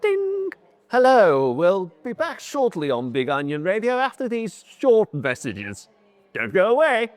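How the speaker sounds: radio announcer. Pretend. Mock radio break announcement.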